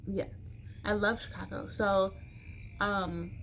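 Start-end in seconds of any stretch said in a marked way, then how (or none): none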